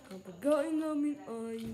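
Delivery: ordinary talk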